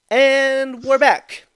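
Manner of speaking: slow, loud and high "we're back"; announcer shout. Acted. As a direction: call it out like a show host. Announces return from break.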